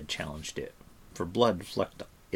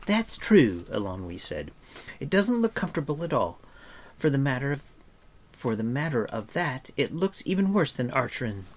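put-on higher-pitched character voice for a chatty girl; Eilonwy, matter-of-fact; 5 semitones higher than usual. A narrator's reading. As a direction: lift the pitch and chatter frankly.

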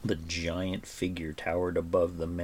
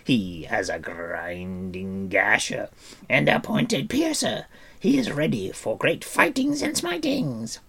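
high, loud, swooping creature voice; wildly animated creature voice, leaping high; excited Gurgi; 3 semitones higher, 6 dB louder than usual. A narrator's reading. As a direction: boast eagerly as an excitable little creature. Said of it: "he was the most excited".

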